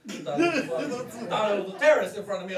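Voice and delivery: laughing, high and fast; startled laugh. Real, not acted. Comic startled by the crowd, laughing loud. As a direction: blurt it out in a laughing panic.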